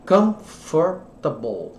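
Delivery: Comfortable stretched out very slowly, syllable by syllable; slow model pronunciation. Shown as a demonstration. Teacher slowing down for students, drawn-out demo word. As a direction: drag out Comfortable so every sound is heard. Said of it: "ó".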